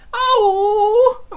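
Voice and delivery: loud, high-pitched yelp; yelp of pain. Acted. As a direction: shriek as if scalded. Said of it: "vonyított fel". (fájdalmas, éles vonyítás)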